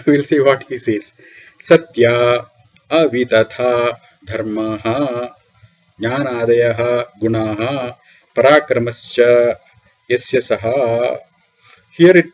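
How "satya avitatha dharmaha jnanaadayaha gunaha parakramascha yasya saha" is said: slow, measured intoning; Sanskrit commentary recitation. Acted. Teacher reading Shankara's words to students, slow recitation. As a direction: draw out each word ending evenly.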